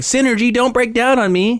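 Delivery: mock plea to her earrings; imitating Jerrica. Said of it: "she kept going like".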